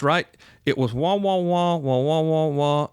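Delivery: usual speaking voice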